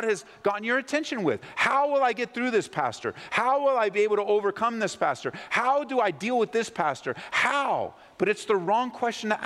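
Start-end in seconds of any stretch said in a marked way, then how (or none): 1.5-7.9 high, pleading how questions, drop on pastor; mock desperate congregant begging the pastor; pleading questioner. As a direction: beg the pastor for answers, voice climbing each time.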